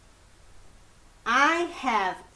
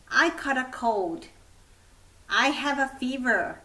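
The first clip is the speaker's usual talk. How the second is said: fast drill read; faster than usual. Shown as a demonstration. Fast repeat drill of phrases.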